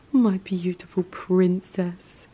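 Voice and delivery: slow unvoiced whisper. Real, not acted. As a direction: whisper it tenderly to the cat. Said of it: "repeated in baby voice".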